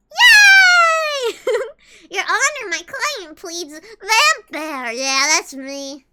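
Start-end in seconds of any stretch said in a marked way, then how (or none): 0.1-1.4 high, loud cheering yelp; mock cheer. Prisoner set free, loud mock cheer. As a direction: cheer like you just got freed. Pretend joy at release.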